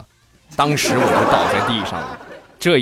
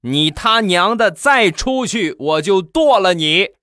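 high, drawn-out acted threat; fierce bellow; 10 semitones higher, 4 dB louder, slower than usual. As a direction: bellow it like a wife at the end of her rope. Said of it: "然后你大喝一声". (大喝一声)

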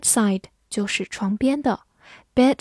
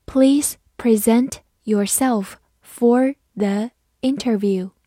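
slow, word by word; slow, deliberate teaching pace; slow read-through; slower than usual. Shown as a demonstration. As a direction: read it slowly with clear gaps between words. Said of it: "慢读一遍".

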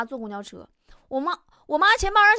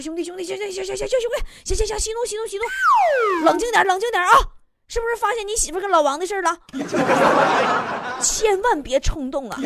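frantic calming; 6 semitones higher, 5 dB louder than usual. Retold or imitated. Neighbors calming a man with a cleaver.

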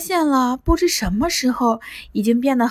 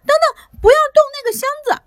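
very high, rushed, panicked cry; boy screaming at the monster to leave his toy box alone; 5 dB louder, much faster than usual. A narrator's reading. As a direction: shriek it to stop him. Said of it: "萝卜连忙尖叫起来".

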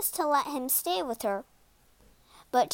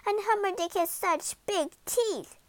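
high-pitched complaining wail; wailing princess; 7 semitones higher than usual. A narrator's reading. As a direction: wail it like a put-upon princess. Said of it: "wailed Poppy".

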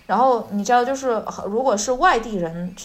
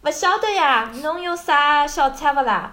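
high, drawn-out Shanghainese shopper asking; customer voice; 5 semitones higher than usual. Pretend. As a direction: play a shopper at the stall. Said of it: "说一遍这个对话".

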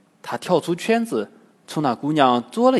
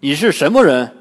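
louder demanding shout; loud challenge; 8 dB louder than usual. A narrator's reading. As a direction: call out to stop her. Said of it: "大声对那姑娘喊道". (大声喝问)

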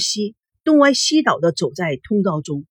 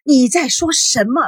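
high, agitated question; bewildered retort called out; raised voice; 6 semitones higher, 3 dB louder than usual. A narrator's reading. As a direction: demand an explanation, voice lifted. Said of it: "赵熙也大声说".

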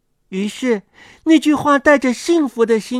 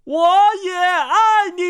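high, sustained answering call; roared reply; 7 semitones higher than usual. A narrator's reading. Forest raises wet fists to answer, calling out. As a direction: call back high without full volume, vowels held. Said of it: "吼道".

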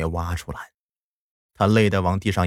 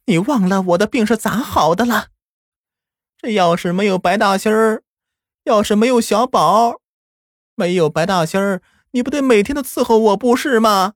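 high, loud stern rebuke; grandma sternly puts mother in her place; 12 semitones higher, 3 dB louder than usual. Acted. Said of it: "奶奶却厉声地说道".

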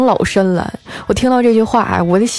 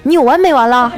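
higher, exasperated snap; angry outburst; 5 semitones higher, 4 dB louder than usual. Acted. Boyfriend fed up with girlfriend's nonstop complaining, angry. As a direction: act out losing patience. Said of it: "就发火说".

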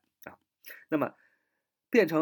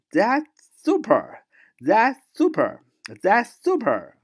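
high, loud, slow "That's super"; excited praise; 9 semitones higher, 7 dB louder, slower than usual. Shown as a demonstration. Teacher acting out delighted praise, high and loud. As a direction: gush with excitement.

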